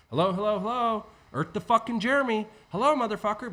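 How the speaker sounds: loud, high hellos hammering for attention; exasperated loud calling to your own brain; insistent calling. Shown as a demonstration. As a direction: yell at yourself to wake up. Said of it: "your soul's getting your brain's attention".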